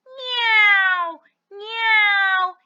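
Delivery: loud, drawn-out meow; imitated cat's cry, long and falling. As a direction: meow out like a calling kitten. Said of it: "എന്ന് കരഞ്ഞുകൊണ്ട്".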